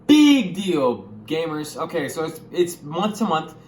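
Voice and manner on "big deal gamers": higher and louder call-out; excited shout to the gamers; hyped. Real, not acted. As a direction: hype up the audience. Hyped address to viewers.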